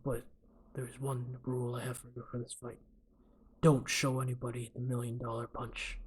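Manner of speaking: low, quiet tough-guy coach; Clint Eastwood. Acted. Coach setting one rule for his fighter, Clint Eastwood impression. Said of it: "what's a good Clint Eastwood impression".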